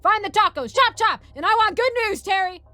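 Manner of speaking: loud, high barked orders; commanding shout. A narrator's reading. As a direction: bellow orders at a subordinate. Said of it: "Sergeant commanded like the true senpai".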